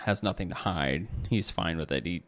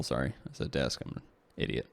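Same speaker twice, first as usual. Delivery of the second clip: whispered, much lower and softer under the breath; self-deprecating aside; 7 semitones lower, 7 dB quieter, faster than usual. Real, not acted. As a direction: mutter the correction to yourself. Correcting own slip aside.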